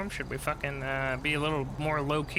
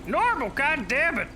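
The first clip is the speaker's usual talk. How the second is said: frustrated outburst; 10 semitones higher, 4 dB louder, slower than usual. Real, not acted. Frustrated voice changer fails.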